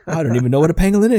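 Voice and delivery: mock bewildered father; South Park dad. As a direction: blurt it out in a panic. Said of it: "And he's like".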